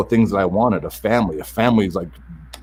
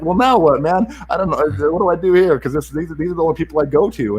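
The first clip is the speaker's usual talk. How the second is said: higher-pitched, exasperated questioning; retold bewilderment; 6 semitones higher than usual. Speaker retells losing his only confidants, bewildered. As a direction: replay being at a loss.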